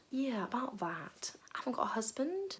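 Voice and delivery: slow, sheepish whispered confession; awkward whisper. Acted. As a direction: whisper it, caught out. Woman awkwardly admits.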